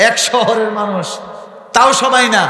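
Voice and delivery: ordinary talk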